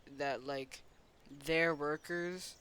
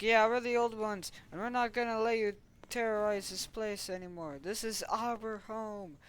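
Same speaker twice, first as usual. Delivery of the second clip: raised pitch, slowing into a stand; defiant declaration; 6 semitones higher than usual. Pretend. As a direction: declare it like heroes defending their home. Old ones defy the intruder.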